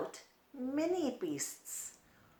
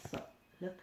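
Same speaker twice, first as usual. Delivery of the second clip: whispered; 12 dB quieter than usual. Pretend. Pointing out hidden stick insect.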